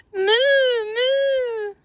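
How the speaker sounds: high, hammed-up moo; cow mooing. A narrator's reading. The confused cow mooing in a high silly voice. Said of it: "who uttered".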